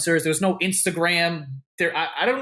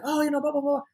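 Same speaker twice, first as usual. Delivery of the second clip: high-pitched stand-in for her chatter; imitated gushing greeting; 11 semitones higher, faster than usual. Star greeting the speaker after a hug, imitated chatter.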